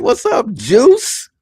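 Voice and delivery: high, loud, slow mocking sergeant voice; mock drill sergeant. Drill sergeant teasing a recruit, high and loud. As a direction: draw out the nickname with a teasing grin. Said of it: "he's like".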